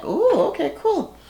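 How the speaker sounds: high mock-impressed oh okay cool; the whole couch faking awe, sarcastically; sarcastic unimpressed. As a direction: fake being impressed, dripping sarcasm. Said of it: "everybody's like".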